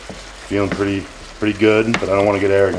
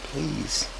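a small, soft please; soft begging; 4 semitones higher, 11 dB quieter than usual. Shown as a demonstration. Begging viewers to subscribe, softly pleading. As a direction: beg quietly. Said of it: "Now I'm begging".

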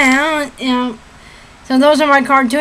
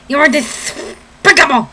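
slow cartoon duck voice; Daffy Duck; slower than usual. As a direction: play the indignant cartoon duck. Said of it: "Daffy Duck, You ready?".

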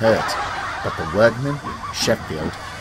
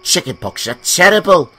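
higher, louder teasing engine voice; teasing James; 4 semitones higher, 7 dB louder than usual. Acted. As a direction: spin a spooky tale to tease. James teases Percy.

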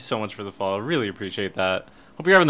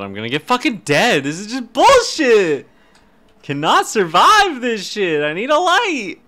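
loud, high exasperated yelling; frustrated outburst; 10 semitones higher, 7 dB louder than usual. Real, not acted. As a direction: build into a high, fed-up shout. Stuck in the dark in game.

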